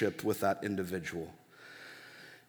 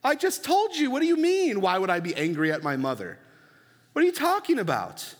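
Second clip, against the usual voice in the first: put-on much higher, baffled teen protest; the son answers the pastor in a much higher, baffled protest; incredulous teen; 6 semitones higher than usual. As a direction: act baffled that he even asked. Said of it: "look at me like".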